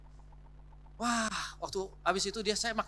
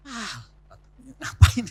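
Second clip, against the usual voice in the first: sulky grumble; 5 semitones higher, 12 dB louder, much slower than usual. Retold or imitated. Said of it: "jangan dicemberutin ya".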